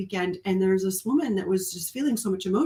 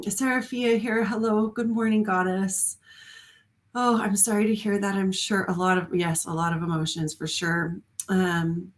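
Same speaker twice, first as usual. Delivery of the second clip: ordinary talk, nothing marked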